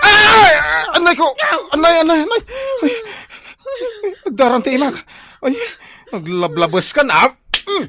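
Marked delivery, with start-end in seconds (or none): 0.9-7.3 high, loud cries of pain; howling in pain, much higher and louder; pained yelling. Acted. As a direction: yell out like you've just been bitten. Husband bitten, cries in pain.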